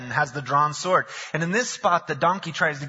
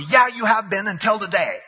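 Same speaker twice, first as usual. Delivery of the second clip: raised, louder angry snap at the donkey; upset retort; 7 semitones higher, 6 dB louder than usual. Acted. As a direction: shout back at the donkey, fed up. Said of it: "Balaam's so upset".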